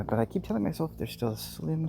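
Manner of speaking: ordinary talk, nothing marked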